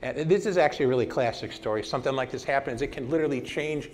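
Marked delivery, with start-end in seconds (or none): none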